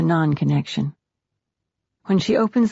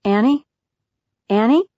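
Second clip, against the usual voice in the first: raised, rising call of a name; louder, higher questioning call; 5 semitones higher, 5 dB louder than usual. A narrator's reading. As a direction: call through a door to check on someone. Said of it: "her brother calls".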